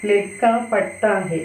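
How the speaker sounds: slow, careful Hindi read for the class; teacher modelling a Hindi sentence slowly for students; slow model sentence. Shown as a demonstration. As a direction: spell out each word slowly like a teacher. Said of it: "ഈ സെന്റൻസുകളുടെ പ്രത്യേകത നോക്കിയേ".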